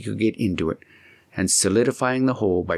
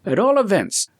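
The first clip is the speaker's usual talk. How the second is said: put-on higher voice for the young friend Heinrich, earnest; a helpful young man laying out a plan, pitched up; 10 semitones higher, 3 dB louder than usual. Heinrich voiced higher than narration.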